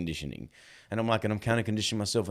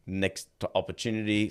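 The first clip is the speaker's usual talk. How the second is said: ordinary talk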